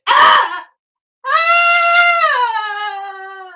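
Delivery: loud, high wailing shouts; loud, high demo of raw crying shouts; raw anguished cries. Shown as a demonstration. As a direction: let the storm rip out of you in loud cries. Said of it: "Emotional storm is more like this".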